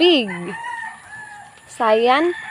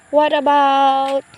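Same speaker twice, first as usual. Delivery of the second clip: normal talking voice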